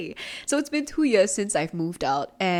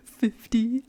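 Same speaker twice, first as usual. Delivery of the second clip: gasping disbelief; 5 semitones higher than usual. Real, not acted. Shocked at own spending.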